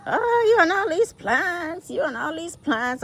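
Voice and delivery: a hammed-up exasperated husband; mocking ex-husband. Mimicking her ex-husband nagging about her plants, high mock whine. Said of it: "my ex-husband used to".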